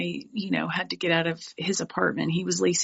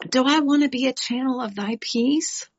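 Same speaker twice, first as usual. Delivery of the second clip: usual speaking voice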